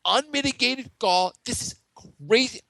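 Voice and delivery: slow, high, hammed-up indignation; mock outrage. Pundit ranting about a sports story, theatrically outraged. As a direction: hammer it out word by word, aghast.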